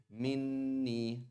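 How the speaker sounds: Minni with a long held double n; Minni, doubled n, smooth ending; smooth pronunciation. Shown as a demonstration. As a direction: lean into the nn of Minni. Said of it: "Ah, that sounds nice".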